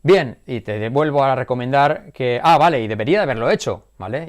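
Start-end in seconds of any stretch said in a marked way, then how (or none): none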